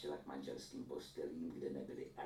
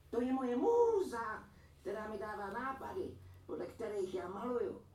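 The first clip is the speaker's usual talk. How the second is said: painter's voice; 10 dB louder than usual. A narrator's reading. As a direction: show off your muse in a lifted, slowed voice. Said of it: "řekl kůžičkář". (vysoký pomalý hlas pyšného malíře)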